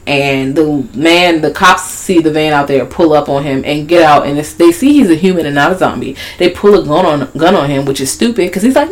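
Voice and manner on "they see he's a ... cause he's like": ordinary talk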